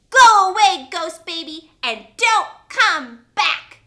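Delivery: high, loud shout, slow at the end; commanding shout. Retold or imitated. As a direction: order the ghost away boldly. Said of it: "and she said".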